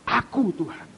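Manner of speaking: ordinary delivery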